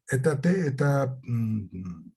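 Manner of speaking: usual speaking voice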